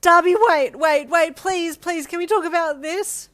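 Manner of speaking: sobbing. A narrator's reading. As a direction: sob it out, begging. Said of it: "you sobbed".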